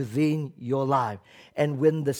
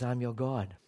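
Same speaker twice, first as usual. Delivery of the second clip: hushed divine voice; 7 semitones lower, 10 dB quieter than usual. Acted. God's voice in story.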